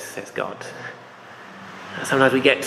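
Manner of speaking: ordinary talk, nothing marked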